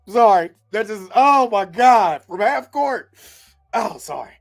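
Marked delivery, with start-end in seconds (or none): none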